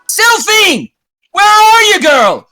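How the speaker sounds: high, loud searching call; captain crying out. Acted. Captain calling for crew member lost in fog, high desperate shout. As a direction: scream for a missing crewmate. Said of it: "your captain cry out".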